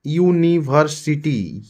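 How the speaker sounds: यूनिवर्सिटी drawn out slowly, starting with a clear यू sound; slow demo word. Shown as a demonstration. Teacher to students, slowly sounding out यूनिवर्सिटी. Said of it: "यो आ रहा है consonant".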